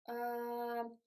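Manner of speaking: soft, drawn-out "um" as a neutral uh; hesitation schwa. Shown as a demonstration. Imitating someone who doesn't know what to say, neutral schwa. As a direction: stall on a long, lax "um". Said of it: "we are standing like".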